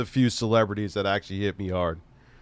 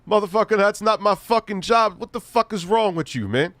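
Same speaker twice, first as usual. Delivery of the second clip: higher, louder retold rant; half-sung mock rage at the coworker; mock angry outburst; 9 semitones higher, 4 dB louder than usual. Retelling his rant at the coworker, higher and louder. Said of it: "I'm like".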